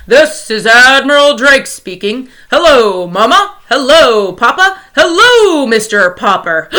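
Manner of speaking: Admiral Drake on the radio. Acted. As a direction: announce it like a crackling radio. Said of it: "a faint voice floated into".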